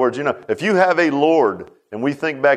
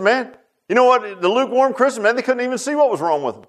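higher-pitched exclaimed mock rebuke; retold rebuke; 8 semitones higher than usual. Jesus to the Laodicean church, retold rebuke.

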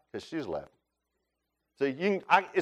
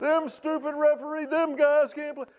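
high, mock-outraged sports fan rant; play-acted yelling at the referee on television; 12 semitones higher than usual. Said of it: "I yell at the stupid T V".

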